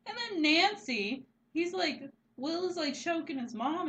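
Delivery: high, loud excited telling. Real, not acted. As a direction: rush it out excitedly. Excited retelling of gory scene.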